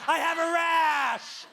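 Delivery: drawn-out slow yell of I have a rash; hammed-up rash cry; mock pained yell. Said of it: "I say something subtle like".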